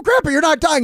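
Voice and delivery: high, loud pleading; exasperated protest. Retold or imitated. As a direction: argue back in disbelief. Grandson arguing back.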